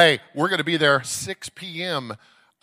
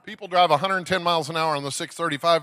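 usual speaking voice

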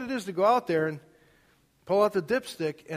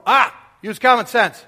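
higher and loud fatherly call back; retold yell; 5 semitones higher, 14 dB louder than usual. Father yelling back to his son, imitated yell. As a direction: shout the reply across the house. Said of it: "And then he'd yell".

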